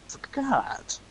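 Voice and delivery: whispered, voiceless self-reminder; whispered realisation. Real, not acted. Realising a stream task was forgotten, whispered. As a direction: whisper it to yourself.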